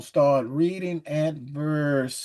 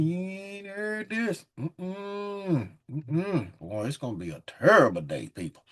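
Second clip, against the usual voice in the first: ordinary talk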